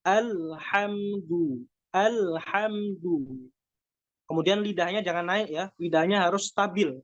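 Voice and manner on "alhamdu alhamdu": "Alhamdu" with lips pushed forward on the final u, slow; rounded lips dhamma. Shown as a demonstration. Teacher showing the full dhamma in "alhamdu", lips rounded. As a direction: round both lips fully on the last u of "alhamdu". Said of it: "memonyongkan kedua bibir".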